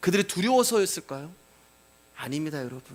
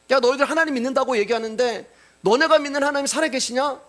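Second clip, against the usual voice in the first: Rabshakeh's mockery; 7 semitones higher than usual. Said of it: "랍사게의 조롱이란 말이에요".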